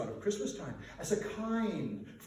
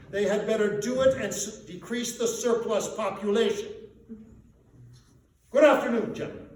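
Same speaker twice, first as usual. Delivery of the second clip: louder, higher sharp ending; contemptuous dismissal; 4 semitones higher, 7 dB louder than usual. A narrator's reading. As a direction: shut them out. Scrooge dismissing gentlemen.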